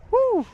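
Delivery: high loud woo; excited whoop. Real, not acted. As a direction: whoop with joy. Cheer after catch.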